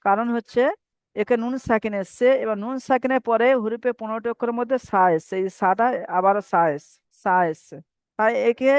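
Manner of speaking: normal talking voice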